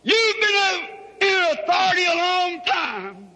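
preacher thundering as Christ at the devil; Christ confronting Satan. Acted. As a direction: call out the enemy slowly, with ringing authority.

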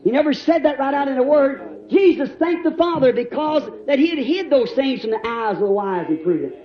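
normal talking voice

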